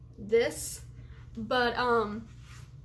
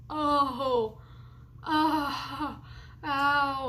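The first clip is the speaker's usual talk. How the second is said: pained groans; 2 semitones higher, much slower than usual. Real, not acted. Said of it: "trying so hard not to cry".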